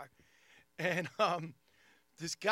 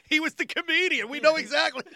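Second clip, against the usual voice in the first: higher, louder tattling witness; mock witness; 9 semitones higher, 9 dB louder than usual. Comic imagining witnesses at the police, mock eager witness. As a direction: play the eager informant. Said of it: "go to the police and go".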